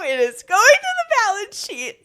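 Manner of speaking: firm retort. Retold or imitated. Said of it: "I go".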